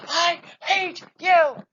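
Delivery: screamed hate, high and loud; a teen yelling right in your face; furious shout. Retold or imitated. Teen girl yelling at her therapist, furious shout. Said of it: "really intensely right in my face".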